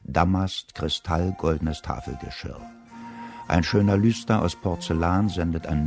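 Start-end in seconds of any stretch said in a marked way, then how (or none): none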